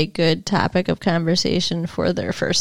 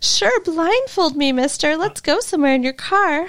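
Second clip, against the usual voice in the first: mock naive girl; 7 semitones higher than usual.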